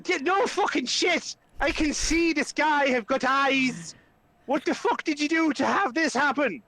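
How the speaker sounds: high-pitched exasperated rant; Flynn, accusing. Acted. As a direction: tear into him without shouting. Flynn rounds on Ismark, accusing.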